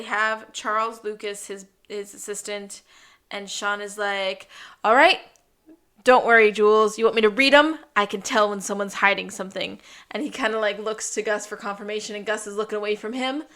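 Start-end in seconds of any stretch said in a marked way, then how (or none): 4.8-9.8 loud, confident showing-off; cocky loud boast. Retold or imitated. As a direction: boast loudly to impress Jules. Sean brags to Jules.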